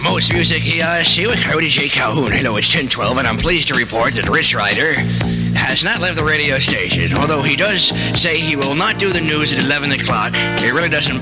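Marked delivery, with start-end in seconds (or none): none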